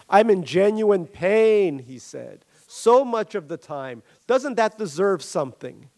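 milk the sympathy; a touch of hammed-up suffering; self-pitying Barry. Barry pleads his pain.

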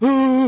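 crowd ooh. Retold or imitated. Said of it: "everybody's like".

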